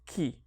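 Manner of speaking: KI said on its own as one syllable; teacher sounding out the syllable "ki"; isolated syllable. Shown as a demonstration. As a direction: sound out "ki" by itself, long clear vowel. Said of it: "read the first one on its own".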